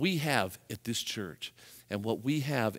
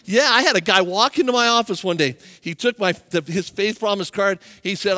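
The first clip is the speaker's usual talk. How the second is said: high, loud revival preaching; preaching at full force; 7 semitones higher, 9 dB louder than usual. Acted. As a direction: preach it hard to the congregation.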